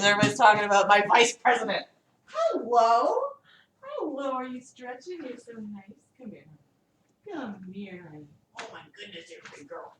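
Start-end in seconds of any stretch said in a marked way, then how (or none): 2.2-9.9 cooing to dog. Real, not acted. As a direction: coo gently to a pet.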